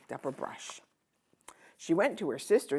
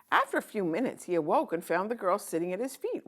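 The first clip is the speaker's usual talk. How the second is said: plain reading voice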